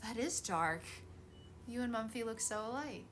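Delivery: ordinary talk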